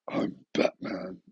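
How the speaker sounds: low, slow whispered Batman line; lightly voiced caped hero; Batman impression. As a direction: growl it quietly like Batman.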